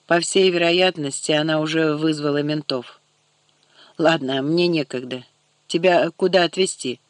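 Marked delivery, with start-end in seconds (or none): none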